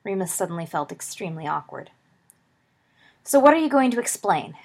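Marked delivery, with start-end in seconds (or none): 3.2-4.6 loud, higher-pitched confrontational question; belligerent. A narrator's reading. Lily confronting Remus about the kiss, belligerent. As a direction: demand an explanation, belligerent and forceful. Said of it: "asked Lily belligerently".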